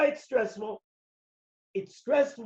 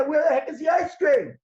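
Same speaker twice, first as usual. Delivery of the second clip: loud, higher angry complaint; angry yell; 4 semitones higher, 4 dB louder than usual. Acted. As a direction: yell at the store clerk. You start yelling at the guy.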